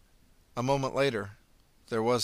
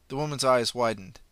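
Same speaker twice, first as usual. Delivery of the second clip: ordinary delivery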